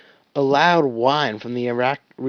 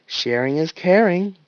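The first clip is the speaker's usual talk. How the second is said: higher, bright chirp; piping up; 6 semitones higher, 4 dB louder than usual. Acted. Doc chirps up to the arguing team. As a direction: chirp it out cheerfully. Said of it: "Doc piped up".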